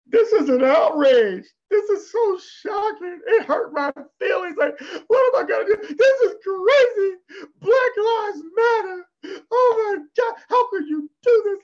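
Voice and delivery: high wailing, sobbing mock complaint; mock crying outrage. Mocking men outraged at losing a job, high hammed-up sobbing wail. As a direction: ham up the whiny crying victim. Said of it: "You got Negroes that are like".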